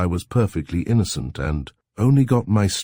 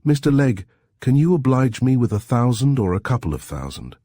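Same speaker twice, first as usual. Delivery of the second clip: mimicking society gent; 8 semitones higher, 3 dB louder than usual. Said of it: "and if they've said".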